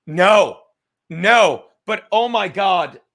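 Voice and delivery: loud, drawn-out disbelief; real loud delight at the story; excited outburst. Real, not acted. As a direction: blurt it out, thrilled. Excited reaction to Brennan.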